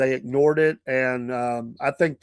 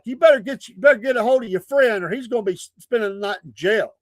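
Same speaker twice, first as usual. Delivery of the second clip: retold hollering; 6 semitones higher than usual. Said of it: "I remember him hollering".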